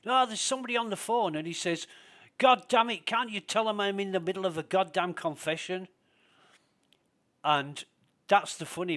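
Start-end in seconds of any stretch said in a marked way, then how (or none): 0.1-1.3 quick, high call across the room. Retold or imitated. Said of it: "somebody shouts to the sheriff". 2.4-5.9 high, loud exasperated outburst; irritated sheriff. Retold or imitated. Annoyed sheriff interrupted.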